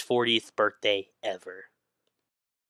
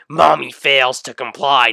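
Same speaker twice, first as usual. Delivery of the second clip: ordinary delivery, nothing marked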